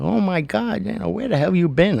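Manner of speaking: high, surprised welcome back; excited greeting. Retold or imitated. Bartender greeting the returning sailor, surprised. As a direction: greet him like a long-lost regular.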